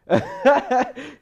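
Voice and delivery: high, loud mocking cackle; imitated laughing. Friend laughing at the girl's text, loud imitated cackle. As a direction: mimic a friend bursting out laughing. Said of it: "he started laughing".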